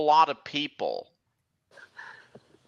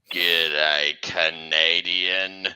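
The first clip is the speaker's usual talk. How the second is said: very deep, slow monster greeting; killer freight car; 10 semitones lower, much slower than usual. Acted. Possessed car closing in on victim, deep slow voice. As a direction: stretch it out low and menacing.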